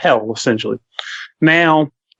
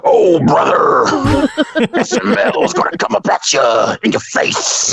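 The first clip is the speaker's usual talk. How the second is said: strained radio voice; 3 semitones higher than usual. Shown as a demonstration. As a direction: force it out from the gut. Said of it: "clench my butthole and let the voice come through".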